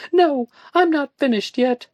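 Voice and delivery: high, loud indignant retort. A narrator's reading. Woman snapping at her friend, indignant. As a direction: fire it back at him. Joanna refuses to leave.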